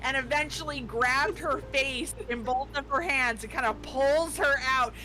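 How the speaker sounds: ordinary talk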